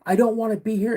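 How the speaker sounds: high-pitched, imitating an upset child; mock a scared kid pleading with Dad; retold hysterical screaming. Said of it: "I start screaming".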